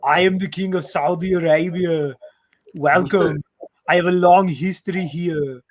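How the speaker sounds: mock Austrian accent; grand welcome in a bad Austrian accent; Arnold Schwarzenegger. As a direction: play an Austrian-accented king. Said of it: "He was like".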